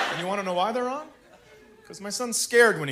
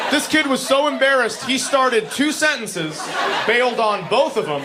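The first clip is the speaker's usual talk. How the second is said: ordinary talk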